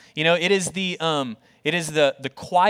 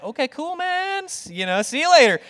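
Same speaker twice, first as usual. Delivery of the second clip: high-pitched mock-friendly goodbye; imitating someone edging out of a chat; awkward brush-off; 10 semitones higher, 3 dB louder than usual. Neighbour backing away from the pastor, awkwardly cheery.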